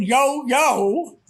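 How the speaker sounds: mock obnoxious call for attention; loud, obnoxious. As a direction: imitate a pushy loudmouth lightly. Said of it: "this one loud, obnoxious voice".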